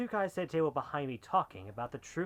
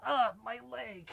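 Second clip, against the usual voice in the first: slow, high, pained groaning words; 9 semitones higher, slower than usual. Acted. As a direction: force the words out through the pain. Shot in the leg.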